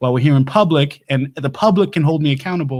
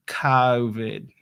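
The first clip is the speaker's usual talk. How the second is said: mock Boston accent on COVID, first vowel broadened; 3 semitones lower, 3 dB quieter than usual. Shown as a demonstration. Coach demonstrates COVID in a mock Boston accent. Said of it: "talking like a Bostoner".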